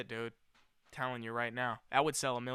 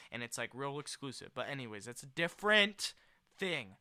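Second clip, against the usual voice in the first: usual speaking voice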